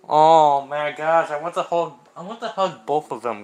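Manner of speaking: loud, squealing gush of affection; overwhelmed with cuteness, let it burst out; loud excited squeal about wanting to hug. Real, not acted. Touched by the friends' scene.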